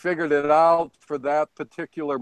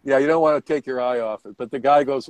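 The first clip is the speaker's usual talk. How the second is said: ordinary talk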